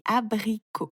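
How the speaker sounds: "abricot" as a, bri, cot; three separate syllables in "abricot". A narrator's reading. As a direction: stretch "abricot" into three beats. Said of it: "tu coupes le mot en petites parties".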